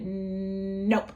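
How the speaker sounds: long-held hum into a popped "nope"; imitated toddler defiance. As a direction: drag out a cheeky refusal. Imitates defiant toddler refusing apology.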